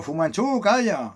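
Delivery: a hint of a fed-up owner shushing his dog; owner hushing dog. Owner scolding his barking dog, mildly voiced. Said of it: "Y el dueño".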